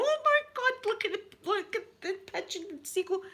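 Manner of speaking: high, startled squeal at the window; shocked shriek. Retold or imitated. Telling mum about the seagull, a high shocked squeal. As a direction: shriek it like you can't believe your eyes. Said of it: "And I was going".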